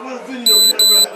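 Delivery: loud demanding yell; shouting. Real, not acted. Yelling for the camera.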